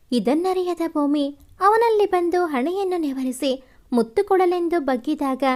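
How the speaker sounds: ordinary delivery, nothing marked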